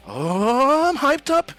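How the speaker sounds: high-pitched jittery hype; acted keyed-up student; Deku, nervous excitement. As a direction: squeal it with nervy anticipation. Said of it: "He's really nervous".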